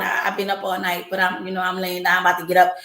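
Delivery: groggy in bed on the phone; sleepy. Acted. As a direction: answer like you just woke up. Answering mother half asleep.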